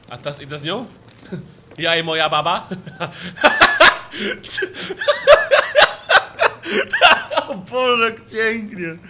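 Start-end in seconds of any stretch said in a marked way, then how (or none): 2.7-7.4 high, loud shrieking laugh. Real, not acted. Streamer cracks up at the grandma. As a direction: burst into a piercing laugh.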